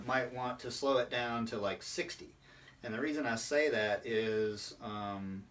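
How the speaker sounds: normal talking voice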